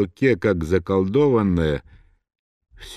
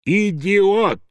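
high, sharply raised insult; furious shout; 9 semitones higher than usual. A narrator's reading. Someone in the audience shouts back, furious. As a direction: hurl it out in fury. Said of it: "в ярости крикнула".

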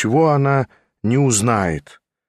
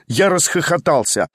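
ordinary delivery

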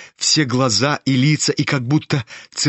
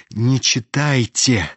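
usual speaking voice

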